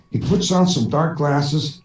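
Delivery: ordinary talk, nothing marked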